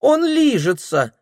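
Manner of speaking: put-on high lisping little-girl baby talk; cutesy baby talk. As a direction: coo it like a small child. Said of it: "сюсюкает быстроглазая Верочка".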